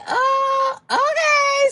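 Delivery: high, drawn-out awkward okay. Retold or imitated. To the date, a high awkward okay. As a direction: brush him off politely.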